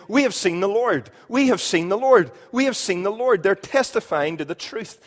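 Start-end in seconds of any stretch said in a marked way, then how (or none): 0.1-3.4 excited testimony. Acted. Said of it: "They're saying".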